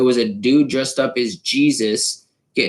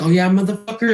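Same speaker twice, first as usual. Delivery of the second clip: pitched-up retold taunt; mock challenge; 7 semitones higher, faster than usual. Friend running at the guy, mock taunt. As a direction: imitate a friend charging in. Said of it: "he's like".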